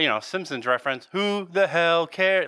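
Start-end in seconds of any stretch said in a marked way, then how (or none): 1.1-2.5 slow, higher cartoon quote voice; quoting Family Guy. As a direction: drag out the Family Guy line. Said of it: "pull another Simpsons re".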